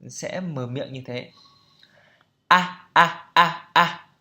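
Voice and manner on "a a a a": exaggerated A for the camera; teacher overdoing the A so students see it; overdone open A. Shown as a demonstration. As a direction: open the mouth too wide on A on purpose. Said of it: "khẩu hình của tôi nó sẽ quá lên".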